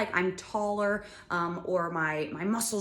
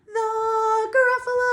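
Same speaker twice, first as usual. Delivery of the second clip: much higher and louder; high sung call; high loud Gruffalo; 9 dB louder than usual. Shown as a demonstration. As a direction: belt the high note. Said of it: "Sing that with me".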